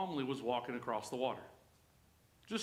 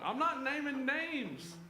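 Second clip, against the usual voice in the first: teasing; 9 semitones higher than usual. Acted. Preacher teasing tall congregants, high laughing mock innocence. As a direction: tease the tall folks while pretending not to. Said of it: "I feel so attacked right now".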